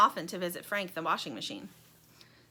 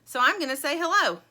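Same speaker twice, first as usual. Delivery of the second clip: raised, louder call across the room; high, loud character call; 7 semitones higher, 8 dB louder than usual. A narrator's reading. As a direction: call it over brightly to a friend. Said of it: "he calls to Lumpy".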